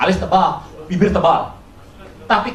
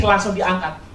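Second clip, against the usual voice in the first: ordinary talk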